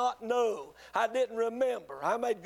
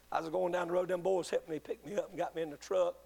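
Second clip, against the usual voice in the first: usual speaking voice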